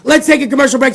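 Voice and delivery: usual speaking voice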